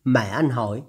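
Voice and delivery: ordinary delivery, nothing marked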